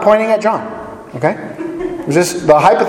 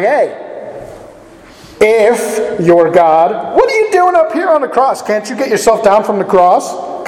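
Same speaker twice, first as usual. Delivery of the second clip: jeering taunt, pitch jumping higher on the question; mocking taunt; 5 semitones higher than usual. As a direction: taunt him from the cross, sneering. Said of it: "the one thief was kind of mocking Jesus".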